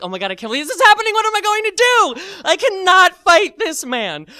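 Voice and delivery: high, loud frantic squeal; mock panic. Teller facing a fight he cannot win, hammed-up high panic. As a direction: race in panicking, then stretch the last plea.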